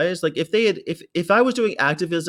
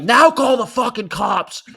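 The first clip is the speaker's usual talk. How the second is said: high, loud, slow angry yell; furious retold yell; 9 semitones higher, 6 dB louder, slower than usual. Activist daring staff to call cops, slow furious yell. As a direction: spit it out, furious and defiant. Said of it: "I was so mad".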